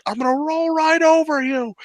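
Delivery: high villain delivery; better dub line. Retold or imitated. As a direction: voice it like a proper villain. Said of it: "He should have said".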